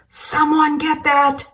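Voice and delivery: high loud slow scream. Acted. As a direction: shriek it across the house. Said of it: "Carol screams".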